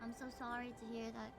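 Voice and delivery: usual speaking voice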